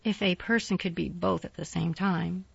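plain reading voice

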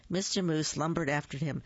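usual speaking voice

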